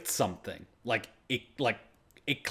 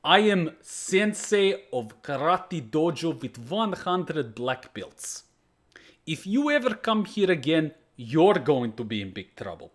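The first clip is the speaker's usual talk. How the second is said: put-on slow, heavy Russian accent, a tough sensei threatening gangsters; old school Russian accent; 3 semitones higher, slower than usual. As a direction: imitate the Russian sensei's accent, drawn out and menacing. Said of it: "classic old school Russian accent".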